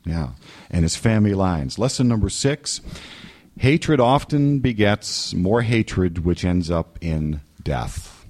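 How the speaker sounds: ordinary talk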